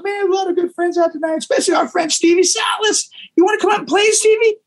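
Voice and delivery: high, hammed-up call from the stage; stage announcement. [name] invites Stevie on stage, high mock stage call. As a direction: announce him to the crowd like a bandleader.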